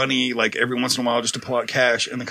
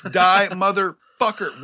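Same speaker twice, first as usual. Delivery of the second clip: slow; 4 semitones higher, much slower than usual. Shown as a demonstration. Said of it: "No, Sarah".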